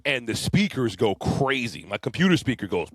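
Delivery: ordinary talk, nothing marked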